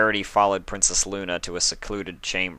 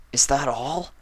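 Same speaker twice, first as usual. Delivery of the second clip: shaking with emotion; 7 semitones higher than usual. A narrator's reading. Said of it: "his voice shaking with emotion".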